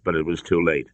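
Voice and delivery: plain reading voice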